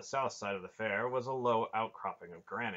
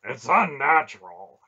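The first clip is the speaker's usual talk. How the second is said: loud, raised grumble; grumbling; 5 semitones higher, 17 dB louder than usual. A narrator's reading. Barak objecting to Relg's strange gift, grumbling. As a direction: grumble it out forcefully. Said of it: "he grumbled".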